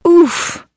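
high comic grunt; a cartoon oof as she falls. Puppy slips on ice.

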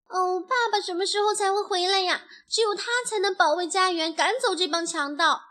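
lightly voiced young chick, fretting; anxious little chick. Voices a chick longing for father.

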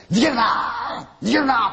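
high, loud, drawn-out shout. Retold or imitated. Storyteller retelling his shout at fleeing crew, long loud yell. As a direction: yell after them to stop running. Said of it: "ってなったんですよ".

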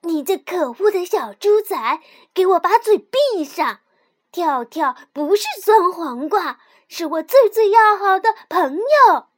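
loud, furious defense building up; angry outburst. A narrator's reading. 蹦蹦 shouts at the piglet to defend his friend. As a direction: finally lose your temper. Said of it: "终于忍不住了".

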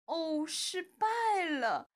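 slow, drawn-out cry of dismay; long-drawn fairy's lament; dejected. A narrator's reading. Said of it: "仙女沮丧地说".

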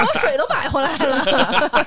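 words tumbling out through laughter; laughing. Real, not acted. Teasing the friend stuck in the chair, laughing. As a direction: laugh your way through the line.

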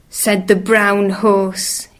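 plain reading voice

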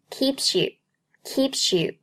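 "keeps you" run together as "keep-syou"; slow demo of "keeps you" linked as one word. Shown as a demonstration. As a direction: slowly carry the s of "keeps" into "you". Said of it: "Keeps和you可以连读成keeps you".

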